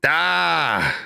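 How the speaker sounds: ordinary talk, nothing marked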